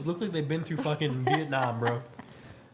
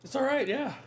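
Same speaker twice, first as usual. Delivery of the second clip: unimpressed; 9 semitones higher than usual. Real, not acted. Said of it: "what do you mean, all right?".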